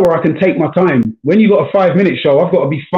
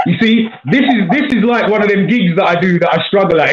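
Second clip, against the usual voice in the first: amused, higher voice breaking into laughs; laughing; 5 semitones higher than usual. Real, not acted. Comedian joking that the dead call is like his gigs, laughing. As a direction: say it through real laughter, pitched up. Jokes about the dead connection.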